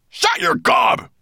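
very high, loud roar; furious shouted command; angry snap. A narrator's reading. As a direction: snap at him to silence him. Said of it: "Nettlebrand snapped at him".

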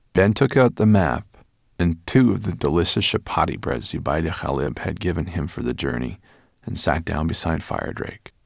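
ordinary delivery

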